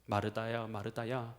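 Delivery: low, soft, hushed address; gently voiced Jesus. Jesus addressing anxious Martha, low and soft. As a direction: call her name low and softly. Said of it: "주님께서 이렇게 말씀하셔요".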